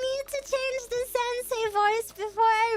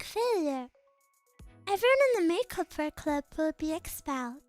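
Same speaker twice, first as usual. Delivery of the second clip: lower, measured and calm; composed noble girl; 6 semitones lower than usual. Acted. Reads a game character's lines.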